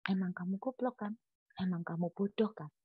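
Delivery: quietly voiced God, deadpan; God's blunt answer. God answers the complaining preacher, soft and blunt. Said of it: "terus Tuhan bilang".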